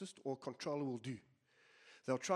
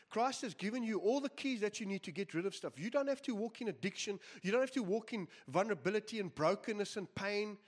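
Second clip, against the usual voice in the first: fiery preaching; 6 semitones higher than usual. Acted. Preacher urging his listeners toward healing, fervently. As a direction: lift the congregation with rising fervour.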